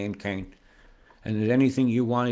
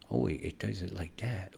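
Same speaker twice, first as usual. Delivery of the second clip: whisper; 2 semitones lower, 7 dB quieter than usual. Real, not acted. Aside while tracing beams.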